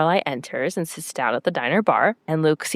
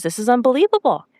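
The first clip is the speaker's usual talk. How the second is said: defiant outburst; 6 semitones higher, faster than usual. Retold or imitated. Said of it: "she stands up and says".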